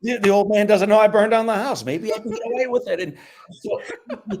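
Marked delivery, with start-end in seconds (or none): 0.0-3.0 gleeful realisation. Retold or imitated. Boy's sudden hopeful thought, acted.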